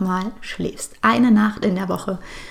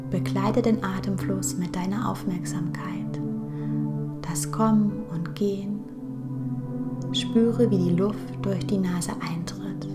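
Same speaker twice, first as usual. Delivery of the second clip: guided meditation; 6 dB quieter than usual. Acted. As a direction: guide her gently into calm, breath by breath.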